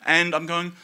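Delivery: usual speaking voice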